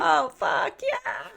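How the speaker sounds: high, breathy swoon sliding up on yeah; mock swooning Penny. Listener mimics Penny overcome by Eli, mock swooning. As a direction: play the overcome heroine for laughs. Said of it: "You're like".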